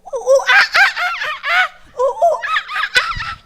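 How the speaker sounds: high, loud monkey hoots; monkey noises. Acted. Monkey impression on stage, high loud hoots. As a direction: hoot like a monkey crossing the stage. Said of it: "that was me. I was acting".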